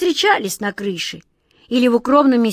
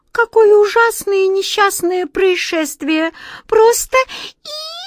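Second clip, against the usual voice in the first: very high, thin squeak; squeaky little mouse; 11 semitones higher, 4 dB louder than usual. A narrator's reading. The littlest mouse squeaking over the fainted queen. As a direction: squeak it like a tiny frightened mouse. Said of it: "тонким голосом пищал он".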